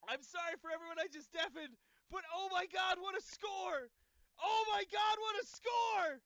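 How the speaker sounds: shrieking, very high and loud; ecstatic screaming. Real, not acted. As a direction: scream with pure joy. Still screaming with joy.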